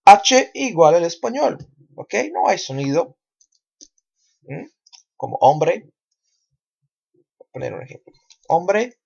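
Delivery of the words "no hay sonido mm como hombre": ordinary talk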